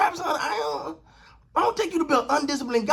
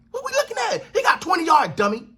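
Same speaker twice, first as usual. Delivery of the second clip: incredulous retort; 8 semitones higher than usual. Real, not acted. Retort to showboating player.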